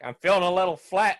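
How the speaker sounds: flattened guy voice. Retold or imitated. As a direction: deliver the pun in a chirpy put-on voice. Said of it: "he's like".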